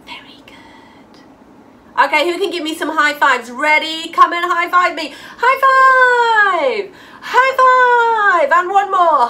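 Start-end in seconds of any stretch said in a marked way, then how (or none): 0.0-1.1 soft breathy very good; whispered praise. Real, not acted. Teacher praising a child in a whisper. 5.4-8.5 loud, high cheer of high five; excited high five call. Real, not acted. Teacher calling kids to high five, loud cheer.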